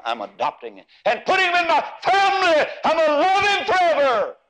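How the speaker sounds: rising to a high, loud, ringing preacher's climax; preaching at full force. Acted. Preacher to congregation on God's choosing, full-force preaching.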